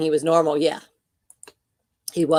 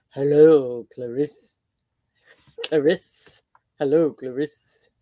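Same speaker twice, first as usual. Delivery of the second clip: menacing movie-villain purr; scary voice; 2 semitones lower, 4 dB louder than usual. Retold or imitated. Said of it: "in a scary voice".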